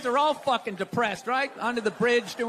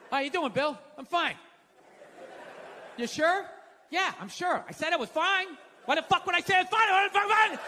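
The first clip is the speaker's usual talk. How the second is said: mock insisting fine; 7 semitones higher than usual. Asked how he is, insists fine.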